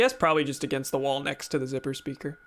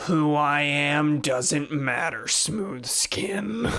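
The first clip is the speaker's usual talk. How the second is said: slow, eerie ghoul voice; much slower than usual. Acted. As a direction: creep them out like a ghoul. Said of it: "I thought I could do a better ghoul voice than that".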